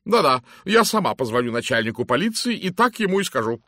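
loud, hearty lady's greeting; booming matron. Acted. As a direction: hail him like a bossy matron. Mrs Masterton decides. (громкий зычный голос дамы)

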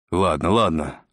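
plain reading voice

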